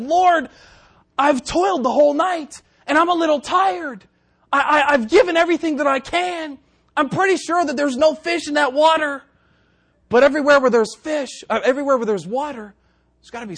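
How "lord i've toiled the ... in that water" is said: high, louder excuse-making; protesting Peter. Acted. Tired Peter making excuses to the Lord, high protest. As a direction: protest up high, pleading your case. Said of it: "but he said".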